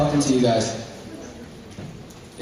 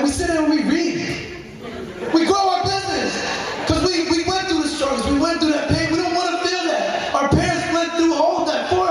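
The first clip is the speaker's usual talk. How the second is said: fired-up shout; 10 semitones higher than usual. Real, not acted. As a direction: preach it like a pep rally. Passionate speech climax.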